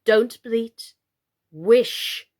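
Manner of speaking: loud, firm command; hard Totty. A narrator's reading. Totty scolding Mr Plantagenet, loud and hard. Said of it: "said Totty hardly".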